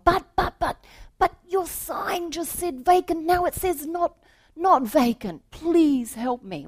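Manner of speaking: high, loud pleading stammer; frantic. Acted. Salesman begging the manager for a room, frantic and high. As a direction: beg in a frantic rush.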